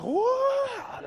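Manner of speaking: very high, surprised "what"; high-pitched mock astonishment; amazed customer. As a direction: imitate a customer's squeal of disbelief. Customer amazed he speaks Spanish.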